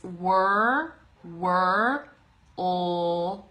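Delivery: put-on "wor", then "old", as separate drawn-out sounds; isolated sounds "wor... old" with pauses; syllable breakdown. Shown as a demonstration. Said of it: "with that word, it's".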